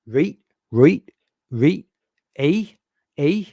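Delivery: loud, rising Canadian "reet" and "eh"; Canadian accent. Shown as a demonstration. Trying out a Canadian impression for the viewers, exaggerated Canadian accent. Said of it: "a decent impression?".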